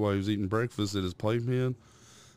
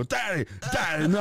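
put-on high whiny toddler protest; 9 semitones higher than usual. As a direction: whine like a toddler begging Dad to stop. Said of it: "He'd go".

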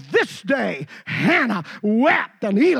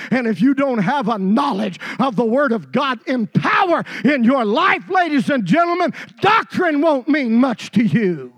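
louder, higher preaching that builds to a shout; full-force preaching; 4 semitones higher, 5 dB louder than usual. Acted. Preacher warning his congregation, full-force preaching. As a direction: drive it home to the congregation.

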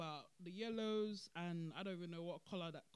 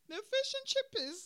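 high, loud, laughing; 7 dB louder than usual. Real, not acted. Vlogger repeating her joke, high loud laughing. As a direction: cackle out the nickname.

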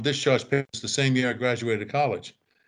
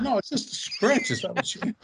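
much higher-pitched mock protest; exasperated retold protest; 11 semitones higher than usual. Soldier retelling his scare to the medics, exasperated protest. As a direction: imitate a rattled buddy insisting, voice jumping up. Friend protests after being shot at.

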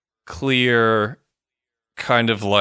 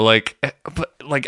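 normal talking voice

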